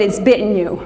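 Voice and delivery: normal talking voice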